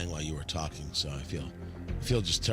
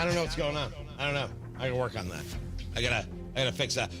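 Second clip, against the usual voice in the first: ordinary talk, nothing marked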